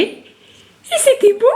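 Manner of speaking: high, slow, sing-song praise. Real, not acted. Mother praising her baby.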